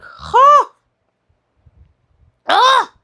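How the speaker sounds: high, loud dismayed "ah"; shocked gasp. Acted. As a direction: gasp as if appalled by what you hear. Mock shock at how they speak.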